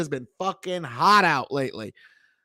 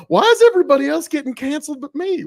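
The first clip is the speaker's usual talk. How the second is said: mock whine; 11 semitones higher, 6 dB louder, faster than usual. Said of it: "I'm like".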